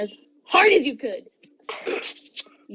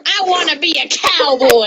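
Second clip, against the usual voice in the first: louder, higher hammed-up cowboy shout; mock cowboy declaration; 6 semitones higher, 7 dB louder than usual. Skeleton announcing his cowboy phase, hammed-up loud. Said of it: "he's like".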